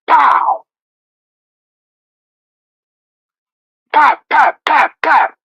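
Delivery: loud, high gunshot pops; imitated gunshots. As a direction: shout out the shots.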